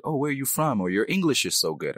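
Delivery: impressed stranger, imitated. Immigrant quoting people surprised by their English, mock-impressed. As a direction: imitate someone pleasantly surprised at good English. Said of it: "you know, initially it's like".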